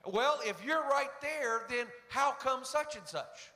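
high, slow and probing, pushing the student; lightly voiced law professor closing the trap; pressing professor. Law professor grilling the cornered student, high and probing.

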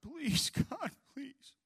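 high, mock-tearful "Please, God"; lightly acted desperate begging; imitating a plea. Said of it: "She started pleading and begging with God".

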